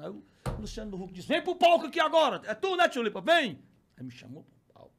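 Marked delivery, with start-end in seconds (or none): 1.3-3.6 high, loud host's summons; calling to the stage. Retold or imitated. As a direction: call someone up over a crowd. Acts host calling him on stage. (chamado de apresentador, bem alto)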